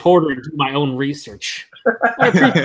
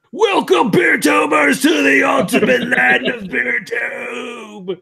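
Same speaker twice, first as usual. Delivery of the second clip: high shouting wrestling promo; Ultimate Warrior promo; 8 semitones higher, slower than usual. Pretend. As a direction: yell it like a pumped-up wrestler. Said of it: "only if I can cut a promo like him".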